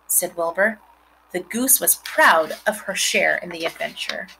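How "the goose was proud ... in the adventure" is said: ordinary delivery, nothing marked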